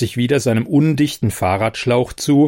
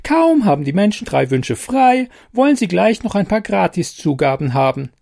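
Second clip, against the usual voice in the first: much higher, squeaky and grumbling; a tiny fairy fed up with greedy humans; 8 semitones higher than usual. Acted. Fairy complaining about humans.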